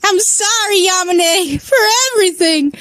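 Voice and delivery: high, hammed-up crying voice; high-pitched mock-wailing plea; shaky, tearful apology. A narrator's reading. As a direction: apologise as if about to sob. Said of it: "It was hoarse, shaky".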